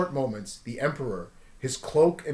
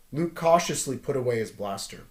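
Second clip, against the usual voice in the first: plain reading voice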